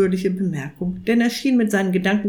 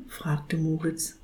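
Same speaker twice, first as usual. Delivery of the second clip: usual speaking voice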